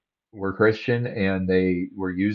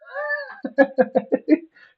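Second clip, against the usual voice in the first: laugh. Real, not acted. Streamer reacting to ranting clip.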